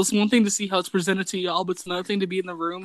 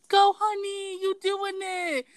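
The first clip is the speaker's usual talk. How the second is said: put-on high, slow sing-song cheer of a girlfriend; mock girlfriend cheering from behind the couch; 12 semitones higher, much slower than usual. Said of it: "she's leaning over your shoulder like".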